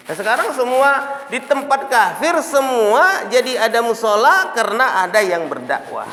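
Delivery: high, drawn-out emphatic sermon; forceful preaching. Real, not acted. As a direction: proclaim it loudly to the congregation. (ceramah lantang, menggebu-gebu)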